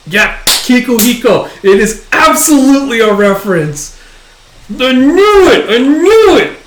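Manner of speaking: high, loud fan outburst; gleeful vindicated yelling; triumphant excitement. Real, not acted. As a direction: crow it like you were proved right. Reader confirms his guess, triumphant.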